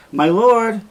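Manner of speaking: a servant calls urgently to her lord through the door; calling through the door. Acted. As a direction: call out to be heard through a door. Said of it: "and she calls".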